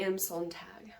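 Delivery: unvoiced whispered name. Real, not acted. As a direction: breathe the name out, barely voiced. Author name trails into whisper.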